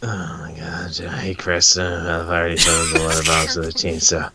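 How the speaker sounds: low, lazy read of a comic character; a hint of a bored teen; voicing the guy in the comic, low and offhand; lightly voiced comic guy.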